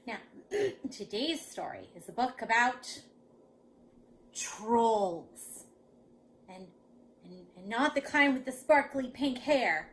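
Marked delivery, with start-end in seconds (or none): 4.3-5.7 drawn-out, weighty "trolls"; ominous emphasis. Shown as a demonstration. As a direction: let the word loom. Announcing story topic spookily.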